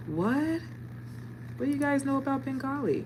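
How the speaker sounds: high, fast disbelief; surprised. Real, not acted. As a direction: caught off guard. Surprised at chat.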